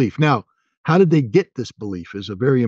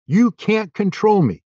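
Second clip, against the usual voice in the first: defiant insistence; 9 semitones higher, slower than usual. Real, not acted.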